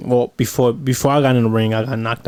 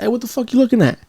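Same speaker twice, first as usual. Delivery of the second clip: confrontational challenge; 10 semitones higher, faster than usual. Retold or imitated. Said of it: "I get out the car and say".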